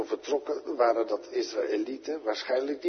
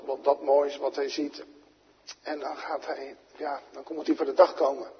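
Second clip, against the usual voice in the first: usual speaking voice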